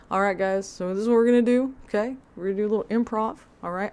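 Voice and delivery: rally the group into an exercise; pep-talk leader. Retold or imitated. The instructor pushing the class into improv, peppy and loud. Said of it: "He made them do improv".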